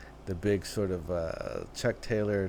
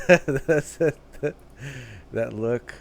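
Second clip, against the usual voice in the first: higher and louder, laughing through it; laughing disbelief; 3 semitones higher, 6 dB louder, slower than usual. Real, not acted. Reacting to the singer's eyes in the clip, laughing. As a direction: laugh it out, floored by the look.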